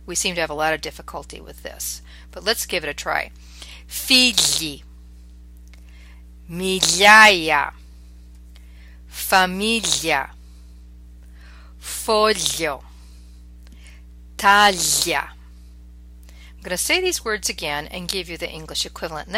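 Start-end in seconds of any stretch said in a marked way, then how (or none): 3.9-15.3 "Figli, migliaia, famiglia, foglio, taglia" with gli as a long palatal ly; word by word, g silent, lingering lly glide; Italian GLI sound. Shown as a demonstration. Teacher modelling the hardest sound, careful Italian gli. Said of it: "pronounce with that y sound".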